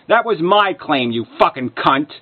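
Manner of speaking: ordinary delivery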